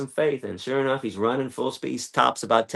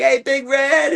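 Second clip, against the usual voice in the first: high, bright call across the field; 12 semitones higher, 4 dB louder, slower than usual. Retold or imitated. Calling to Big Red from the car, a high call. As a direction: hail the bull from the car, much higher.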